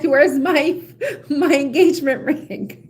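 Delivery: laughing mock demand. Somebody asking after a ring, laughing mock demand. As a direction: play an impatient fiancée, cracking up. Acts impatient partner, laughing.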